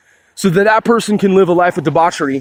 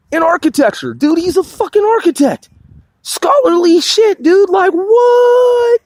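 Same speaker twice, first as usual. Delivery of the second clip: much higher-pitched, incredulous delivery; amazed disbelief; 12 semitones higher than usual. Real, not acted. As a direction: blown away, voice shooting up high. Amazed at rapper's architecture degree.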